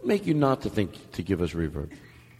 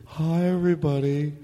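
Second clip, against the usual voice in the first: mock film director; 2 semitones higher than usual. Host playing the director opening his film, put-on storyteller.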